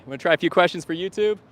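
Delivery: high, loud and fast, inviting strangers over; calling out to passersby. Real, not acted. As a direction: hail people walking by cheerfully.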